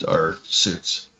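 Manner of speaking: ordinary delivery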